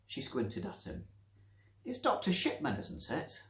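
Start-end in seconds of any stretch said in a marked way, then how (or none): 1.8-3.4 high triumphant guess; victorious. Acted. Nancy recognising the doctor, triumphant. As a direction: nail the name proudly. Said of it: "She sounded victorious".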